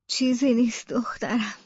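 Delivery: faint, breath-only voice of a sick mother; low, halting reassurance; weak, ailing whisper. Acted. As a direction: whisper with little strength left. Collapsed mother speaks weakly to daughter.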